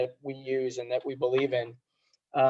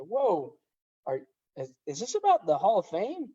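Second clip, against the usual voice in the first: retold surprise; 5 semitones higher than usual. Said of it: "I was like".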